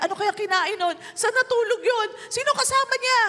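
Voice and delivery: worried mother. Retold or imitated. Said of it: "Siguro sabi ni Mary".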